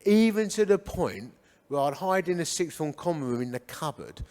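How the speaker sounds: ordinary talk, nothing marked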